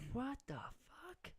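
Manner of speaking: high, strained, pulled-back gasp of disbelief; incredulous what the fuck; shocked disbelief. Retold or imitated. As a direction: blurt it out stunned. Retold shock at raft theft.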